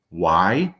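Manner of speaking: ordinary talk, nothing marked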